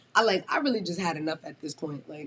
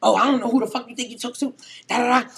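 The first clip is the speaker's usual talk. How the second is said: louder, higher, fast; angry mock yell; 5 semitones higher, 8 dB louder, faster than usual. Coworker yelling back at her in the kitchen, angry mock yell. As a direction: bark it back fast and loud.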